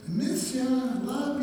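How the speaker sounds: a hint of a sweet kid sister begging him home; little sister.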